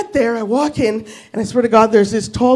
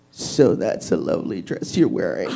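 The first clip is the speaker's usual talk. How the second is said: much lower, soft leering line; creepy low come-on; 9 dB quieter than usual. Retold or imitated. Cab driver leaning back to flirt, low and creepy. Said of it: "He leans back and he goes".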